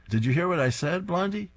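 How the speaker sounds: calling out. Acted. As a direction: call out from the kitchen. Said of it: "He calls to Blondie".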